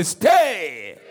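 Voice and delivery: loud, drawn-out preacher's cry; exhorting call. Real, not acted. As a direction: call it out to the whole congregation. Preacher exhorts congregation to repeat.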